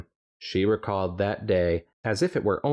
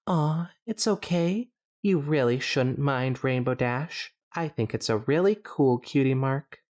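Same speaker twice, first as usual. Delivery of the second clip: gentle Fluttershy voice; 6 semitones higher than usual. Acted. Fluttershy comforting Derpy.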